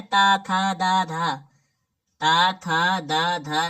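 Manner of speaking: ता, था, दा, धा each sounded out clearly; teacher reciting ता था दा धा; reciting the ta row. Shown as a demonstration. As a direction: drill the dental row for students. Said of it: "तो मतलब ता वर्ग में आता है".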